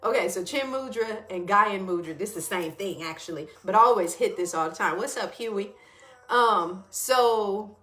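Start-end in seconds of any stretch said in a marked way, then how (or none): none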